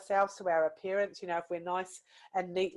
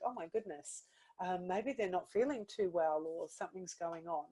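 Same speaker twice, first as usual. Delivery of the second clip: much softer, inward worry; concerned thought; 7 dB quieter than usual. Retold or imitated. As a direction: think it quietly to yourself. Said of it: "and think".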